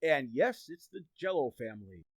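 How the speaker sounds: ordinary talk, nothing marked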